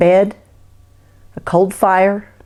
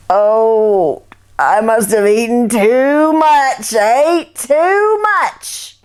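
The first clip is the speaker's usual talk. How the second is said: groaning overfull; 3 semitones higher, 4 dB louder, much slower than usual. Acted. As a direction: moan it like your belly aches. Jake groans over a full stomach.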